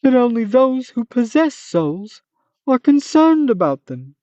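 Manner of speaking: commit to the silly monster voice; Manticore voice. Acted. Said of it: "I'm committed to the bit now".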